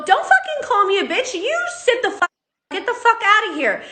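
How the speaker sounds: high, heated yelling at LeBron; retold angry yelling. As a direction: shout it back at him, heated. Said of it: "And I go".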